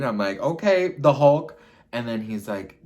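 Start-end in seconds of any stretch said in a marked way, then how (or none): 0.4-1.6 high, loud quip; loud, high-pitched mock reaction; mock alarm. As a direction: exclaim it as a teasing jab. Mocking his sudden turn.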